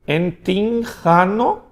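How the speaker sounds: "Entinjano" said slowly, syllable by syllable; slow pronunciation demo. Shown as a demonstration. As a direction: read "Entinjano" slowly for students. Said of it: "Pronúncia do gama?".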